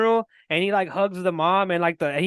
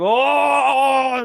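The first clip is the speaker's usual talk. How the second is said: drawn-out higher, louder moan of delight; awed cry; 4 semitones higher, 4 dB louder than usual. Retold or imitated. Fan reliving his reaction to a great line, awed cry. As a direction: wail it like an overwhelmed fan.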